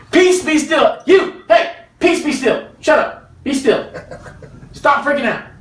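hammed-up exasperated Jesus; playful exasperated command to the storm; comic Jesus rebuke. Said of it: "Sorry, that was me. That's the [name] translation".

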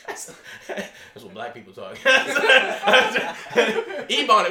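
giggling through the words at a mistake; laughing. Real, not acted. Amused at his own mix-up, laughing as he speaks. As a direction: laugh while fumbling for the word.

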